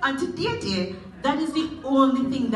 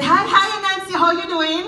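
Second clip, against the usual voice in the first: high, loud fake-friendly hello; the old man greeting Anansi, all teeth; false friendly greeting; 7 semitones higher, 5 dB louder, faster than usual. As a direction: greet him with a big toothy grin.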